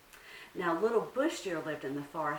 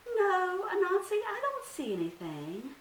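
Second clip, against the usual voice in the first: much higher, louder innocent little deer; Little Bush Deer; 14 semitones higher, 5 dB louder than usual. Acted. Little deer plays innocent to trick Anansi, high and sweet. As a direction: act innocent, a small high voice.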